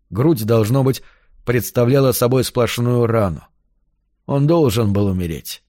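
ordinary delivery

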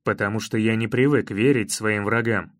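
low-pitched, contained anger; angry reply. A narrator's reading. Hero refusing to trust his enemy, angry. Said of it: "зло ответил я".

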